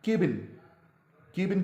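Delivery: केबिन with an "ay" vowel; common mispronunciation. Shown as a demonstration. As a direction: say केबिन as KAY-bin. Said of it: "which is again wrong". (केबिन, "के" वाली आवाज़ के साथ)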